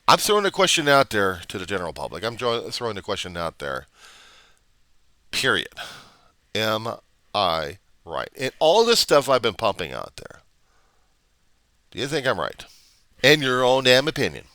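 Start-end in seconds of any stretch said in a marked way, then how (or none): none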